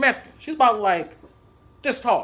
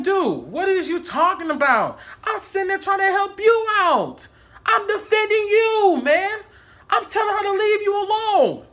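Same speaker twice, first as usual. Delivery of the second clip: high-pitched, exasperated pleading; indignant protest; 8 semitones higher than usual. Retold or imitated. Defending himself to an angry coworker, indignant protest.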